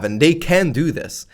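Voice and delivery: normal talking voice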